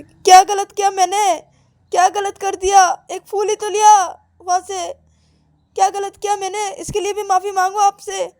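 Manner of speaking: high, loud aggrieved questioning; indignant protest. Acted. Girl arguing her innocence to God, indignant and high.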